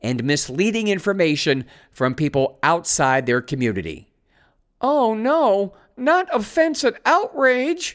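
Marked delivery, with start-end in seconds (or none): 4.8-7.9 high, drawn-out mock dismay; sarcastic "oh no", high and slow; mock horror. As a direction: feign shock at something trivial, pitched way up. Mock horror at the article's words.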